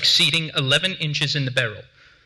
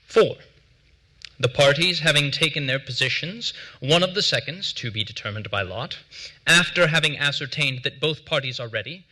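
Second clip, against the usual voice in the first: ordinary delivery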